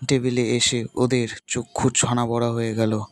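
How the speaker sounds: ordinary delivery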